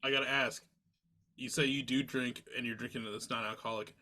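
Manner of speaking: ordinary talk, nothing marked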